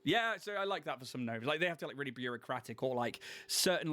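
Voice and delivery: ordinary delivery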